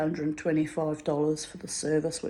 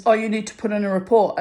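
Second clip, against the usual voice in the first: quick, louder official prodding; pushy sergeant; 4 semitones higher, 7 dB louder, faster than usual. Retold or imitated. Sergeant grilling her about a report, fast and loud. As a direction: fire the question at her fast.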